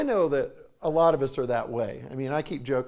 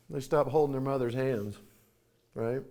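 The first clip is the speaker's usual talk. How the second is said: usual speaking voice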